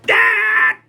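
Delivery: loud, very high yelp; wordless high holler mimicking a yelling boss; sudden piercing mock scream. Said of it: "You just like yelling at people".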